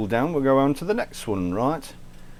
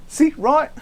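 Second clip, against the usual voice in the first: high teasing squeak; 5 dB louder than usual. Acted. Teasing after the accent bit.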